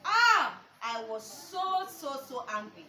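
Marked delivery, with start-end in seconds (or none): 0.1-0.6 loud, high cry of protest; outraged "ah". Retold or imitated. Mother hearing the scan result, loud outraged cry. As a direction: let out one big, indignant "ah".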